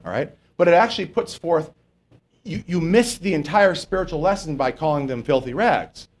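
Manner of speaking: ordinary talk, nothing marked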